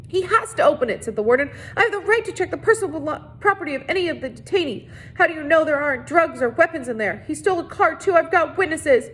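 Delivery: high, frantic insisting; losing control, pitch climbing; nearly hysterical. A narrator's reading. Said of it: "She was nearly hysterical".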